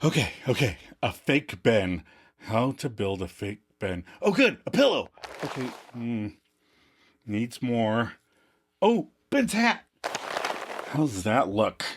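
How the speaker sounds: lightly acted father muttering through a project, with excited outbursts; puzzling, then delighted; dad busy crafting, talking to himself. Performs sketch as dad.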